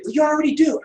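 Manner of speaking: high-pitched mimicking voice; imagined listeners' objection. Teacher voicing what students are thinking, high mimicry. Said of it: "I know what you're thinking".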